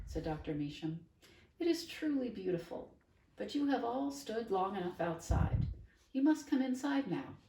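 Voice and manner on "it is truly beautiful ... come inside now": higher, ending in a loud come; shepherd them in warmly but firmly; old woman insisting the guests come in; firm invitation. Acted. Doctor ushers everyone inside.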